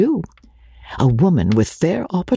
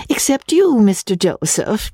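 high, playful jab after a giggle; single him out playfully, pitch jumping up; teasing laugh; 9 semitones higher than usual. A narrator's reading. Guest teases her friend's brother, lightly amused. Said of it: "she added with a laugh".

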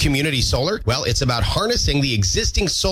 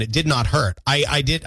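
ordinary delivery

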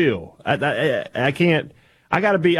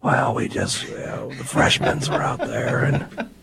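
hoarse coach voice; 5 dB quieter, slower than usual. Retold or imitated. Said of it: "The Stansberry mid-season is like".

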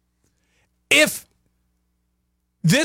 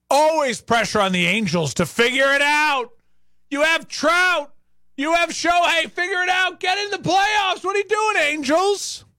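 rising, higher exasperated pundit voice; mock media pressure; 7 semitones higher than usual. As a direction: hammer the Angels like a pushy pundit. National media pressure on the Angels.

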